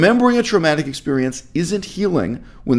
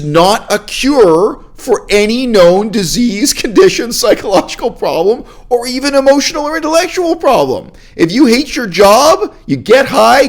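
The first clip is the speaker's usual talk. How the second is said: raised, high-pitched emphatic tirade; exasperated rant; 6 semitones higher, 4 dB louder than usual. Real, not acted. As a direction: drive each point home, exasperated. Ranting against the retreat's promised cure.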